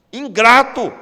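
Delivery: one loud word, high and hard; brand them ungrateful; loud rebuke. Acted. Pastor to the congregation, loud rebuke. Preacher condemns ingratitude.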